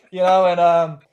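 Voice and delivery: ordinary talk, nothing marked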